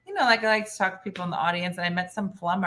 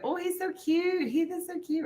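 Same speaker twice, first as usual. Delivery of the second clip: put-on high, soft swooning girl voice; 8 semitones higher, 6 dB quieter than usual. Everybody at the contest gushing about the cute guy, high swoon. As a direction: gush over him like a smitten girl. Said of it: "Everybody's like".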